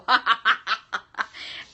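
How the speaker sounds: a short burst of real laughter. Real, not acted. Medium laughing at the grandmother's piercing eyes, real laugh. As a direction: laugh out loud at the thought of those eyes.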